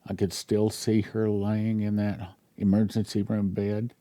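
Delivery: normal talking voice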